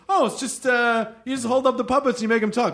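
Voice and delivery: high, breezy mock naivety; lightly mocking the beginner; naive newcomer. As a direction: play it off as easy. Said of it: "you just go".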